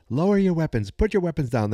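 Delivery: mock yelled order. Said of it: "he is yelling at the other guards".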